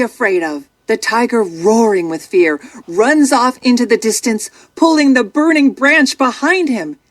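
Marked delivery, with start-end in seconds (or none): none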